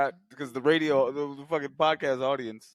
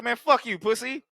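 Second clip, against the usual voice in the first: mock tough-guy taunt, higher; Clarence taunting; 9 semitones higher than usual. Clarence mocking RoboCop through the glass. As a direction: sneer it like a cocky thug. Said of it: "he's like".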